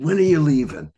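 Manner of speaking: eager, high, loud blurted question; excited child. Retold or imitated. Child to visiting grandmother on her arrival, excited and blunt. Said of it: "I was so excited to see her".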